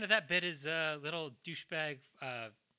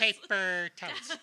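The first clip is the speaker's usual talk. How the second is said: slow, higher, giggling words; laughing; 5 semitones higher, 7 dB louder, slower than usual. Real, not acted. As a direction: laugh it out slowly. Laughing echo.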